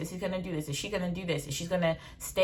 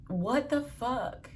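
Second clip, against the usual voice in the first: slow, drawn-out and softer; slow disbelief; 5 semitones higher, 4 dB quieter, much slower than usual. Real, not acted. Repeating her reaction to viewers, slow and drawn out. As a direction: stretch every word in quiet disbelief.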